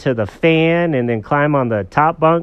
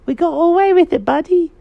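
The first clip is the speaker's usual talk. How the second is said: high, louder conspiratorial glee; high-pitched pleased storybook cat; gloating cat character; 6 dB louder than usual. Acted. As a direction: crow over getting away with it.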